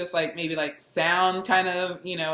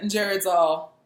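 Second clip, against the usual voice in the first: ordinary talk, nothing marked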